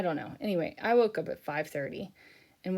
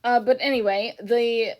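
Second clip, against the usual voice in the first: usual speaking voice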